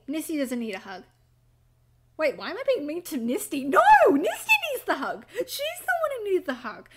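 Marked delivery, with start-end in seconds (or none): 3.6-6.9 high, loud outburst, easing in volume; emphatic protest. Real, not acted. Correcting oneself about who needs the hug, protesting. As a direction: cry out in protest, pitch soaring.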